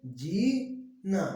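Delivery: slow drawn-out जी, then ना; cuckoo-like teaching demo; relaxed melodious voice. Shown as a demonstration. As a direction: linger sweetly on each syllable. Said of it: "आराम से सुरीली आवाज में बोलते हैं".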